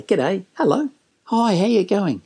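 bright friendly g'day, lifted in pitch; cheery greeting. Shown as a demonstration. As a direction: greet them with a wink. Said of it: "wink a bit and say".

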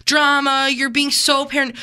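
high-pitched whiny mockery of doubters; mocking dismissive. Imitating people who dismiss women's fears, high mocking voice. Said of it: "haven't been validated by anybody in their life".

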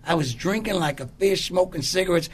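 ordinary talk

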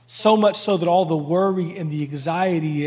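ordinary talk, nothing marked

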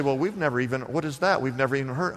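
low-pitched, lightly voiced baffled listeners; puzzled congregation. Preacher voices his congregation answering him, mildly baffled. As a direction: drop the pitch for a mildly clueless reply. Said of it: "all of you say".

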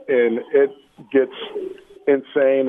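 usual speaking voice

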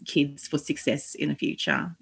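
ordinary talk

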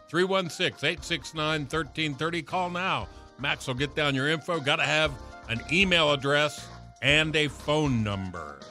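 high, energetic radio host pitch; radio contest promo. Acted. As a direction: hype up the call-in giveaway. Radio host contest call-to-action.

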